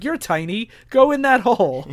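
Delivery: playful imitation of a pushy command; mock order. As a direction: order a small kid in, building to a loud finish. Childhood order, acted out.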